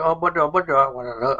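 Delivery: slow, loud, high mimic of the driver saying no; mock driver refusing. Blind passenger mimics the driver refusing to open the door, slow and loud. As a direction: drag out the refusal like the stubborn driver. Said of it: "he's like".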